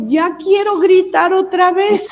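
a hint of a scared little girl; anxious little girl. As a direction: voice the nervous daughter, pleading slowly. Stressed daughter asks to scream.

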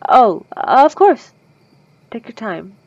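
vampire lets the listener take their time, slow and gracious; gracious reassurance. Acted. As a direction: eagerly grant the delay.

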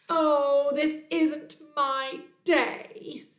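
high, slow, drawn-out lament; woeful Bear. Acted. Bear's scratch ends in disaster, slow mock wail. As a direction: moan it with exaggerated exasperation.

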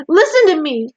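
high, loud plea; urgent cry. A narrator's reading. Wilbur begs the rat to listen, crying out. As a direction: cry out to be heard. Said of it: "cried Wilbur".

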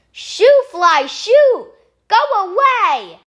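high, loud shooing; shooing shout. Acted. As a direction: chase the fly off. Chasing fly away.

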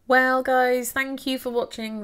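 loud, high outro opener; bright sign-off call. Real, not acted. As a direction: call out to the viewers. Outro greeting.

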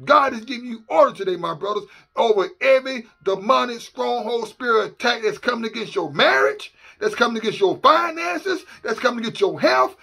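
ordinary delivery

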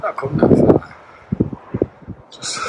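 ordinary delivery

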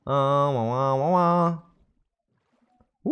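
slow fake wah-wah crying; mock wailing. Streamer fakes a crying wail after losing the chest. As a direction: drag out a pretend cry.

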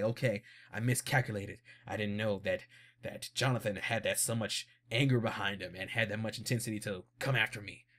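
scheming Dio. Retold or imitated. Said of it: "So like".

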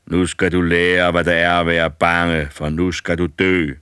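loom over him and promise death; menacing old giant. A narrator's reading. Old man threatens.

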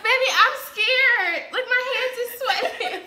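scared giggling; nervous laughing. Real, not acted. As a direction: giggle through the nerves. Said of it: "I wanna cry".

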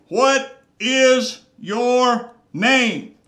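loud, very slow and drawn-out demand; commanding question. Acted. Jesus demanding the demon's name, slow and commanding. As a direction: draw it out like a stern command.

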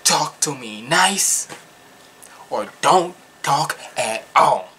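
slow, firm quoted line; slowed and pressed harder; slow emphatic quote. Retold or imitated. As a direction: lay the quote down word by word. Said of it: "it's like what Schoolface John says".

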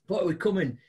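ordinary talk, nothing marked

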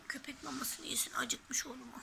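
soft breathy whisper. Shown as a demonstration. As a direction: whisper it to the toy dog. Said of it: "repeated as whisper".

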